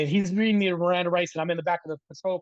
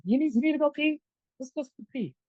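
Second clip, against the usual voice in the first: higher, falsely chummy officer; mock friendly cop; 7 semitones higher, 3 dB louder than usual. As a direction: play the cop turning sweet, pitched up. Officer suddenly friendly to boy.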